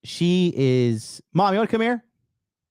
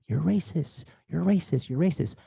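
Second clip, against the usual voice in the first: mock accusers; 5 semitones higher, 5 dB quieter than usual. Mocking accusers' chant.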